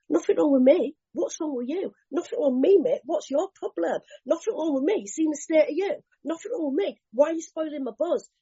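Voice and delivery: high-pitched, indignant comeback; mock-defensive, pitched well up; instinctive defensive retort. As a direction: act out touchy denial, throwing the blame back. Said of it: "My natural instinctive response is".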